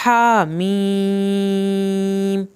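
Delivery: "ہا میم" slowly, throaty h and long held i; throat ha. Shown as a demonstration. Teacher showing the throat letter, elongated "ہا میم". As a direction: chant "ہا میم" from the throat, drawn out. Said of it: "ہا کو آپ نے حلق سے پڑھنا ہے".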